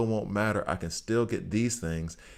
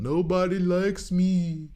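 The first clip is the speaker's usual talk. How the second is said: high, slow whiny self-pity; mock whiny; 10 semitones higher, 4 dB louder, slower than usual. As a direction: whine it like a sulking kid. Mock self-pity about empty guild.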